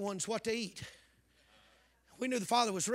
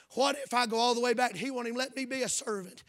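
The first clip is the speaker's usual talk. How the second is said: ordinary delivery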